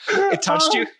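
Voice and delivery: very high-pitched disbelief; astonished, voice leaping high; incredulous question. Real, not acted. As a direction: squeak it out in amused disbelief. Incredulous at co-host being moved.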